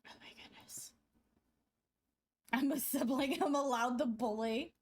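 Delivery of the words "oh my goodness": breathless whisper with no voice behind it. Real, not acted. Streamer whispering in disbelief at chat's teasing. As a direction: drop to a bare whisper, exasperated. Exasperated at chat, under her breath.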